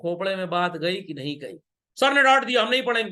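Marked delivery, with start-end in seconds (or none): 2.0-3.1 put-on loud, high, whiny protest of a scolded kid; sulky student voice. A student who was scolded refuses to study, loud and whiny. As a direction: play the pupil sulking after a scolding, pitched up.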